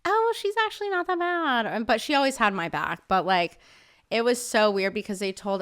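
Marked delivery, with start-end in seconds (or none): 0.0-1.7 very high-pitched mock mom voice; imitated mom. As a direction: lift it way up, imitating mom excusing someone. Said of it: "my mom was like".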